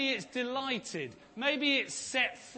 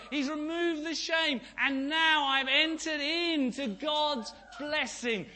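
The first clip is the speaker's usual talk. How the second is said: higher and louder, rising proclamation; fiery sermon climax; preaching at full force; 6 semitones higher, 3 dB louder than usual. Real, not acted. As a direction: preach it out, declaring victory. Preaching climax on removed shame.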